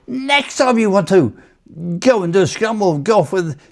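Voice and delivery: high-pitched sergeant impression; staff sergeant. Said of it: "this staff sergeant".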